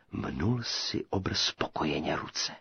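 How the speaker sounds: ordinary delivery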